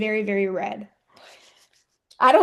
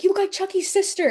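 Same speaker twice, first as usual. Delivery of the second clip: mild mock yell; 9 semitones higher, faster than usual. Wrestler quoting the heckling fan once more, quick high mock yell. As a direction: imitate the heckler, pitch jumping up, volume held back. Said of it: "he yells, and you can hear it on tape".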